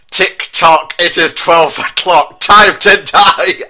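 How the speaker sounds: Big Ben taunting before firing; villain taunt. Acted. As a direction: menace Garfield like a cartoon villain.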